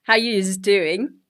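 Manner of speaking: very slow imitation of a Queensland greeting; lightly put-on Queensland Australian, drawn out; Australian Queenslander. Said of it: "if you hear an Australian go".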